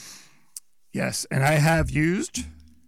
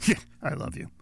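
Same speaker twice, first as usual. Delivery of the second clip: "Yeah, I love you" tossed off breathy and dismissive; offhand "Yeah" that sinks the "I love you"; sarcastic; 9 semitones higher, 3 dB quieter, faster than usual. Shown as a demonstration. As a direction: say it like you don't mean it. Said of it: "one's gonna get you a slap".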